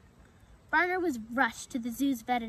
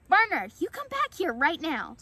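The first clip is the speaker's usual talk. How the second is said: loud, raised-pitch parental order; stern call; 6 semitones higher, 7 dB louder than usual. Acted. Mother to her bolting fawn, a loud stern call. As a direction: call the runaway back sharply and loudly.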